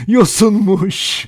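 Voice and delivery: high, loud, stretched-out hysterical cry; hysterical laughing. A narrator's reading. Vera realising it was seaweed, hysterical and high. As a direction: gasp it out in crazed amusement. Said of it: "Delicesine gülmeye başladı".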